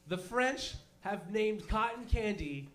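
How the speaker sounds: ordinary talk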